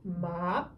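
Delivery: "Ma" dips low then rises; dipping third tone. Shown as a demonstration. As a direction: drop "Ma" down and bring it back up. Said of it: "the descending and rising tone".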